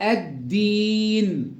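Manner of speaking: الدين slowly, doubled d and long ee; lam merged into d. Shown as a demonstration. Teacher shows the merging, slow doubled d. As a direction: stretch الدين with the lam silent. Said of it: "أضغمت اللام في الدال".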